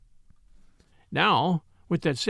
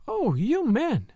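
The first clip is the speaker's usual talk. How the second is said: high, drawn-out wifely exclamation; exasperated cry; 8 semitones higher, much slower than usual. A narrator's reading. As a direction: cry out slowly at men's blindness, pitched high. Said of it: "cried his wife".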